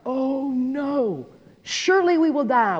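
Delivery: exaggerated panic at certain doom; mock dread. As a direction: wail in hammed-up alarm. Acts Manoah terrified on the ground.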